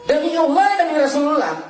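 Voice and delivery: high, ringing declaration of an oath; solemn oath lifted high above normal talk; preached oath. Acted.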